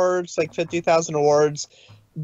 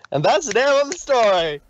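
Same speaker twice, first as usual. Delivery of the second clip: cheerful ending; 7 semitones higher, 3 dB louder than usual. Acted.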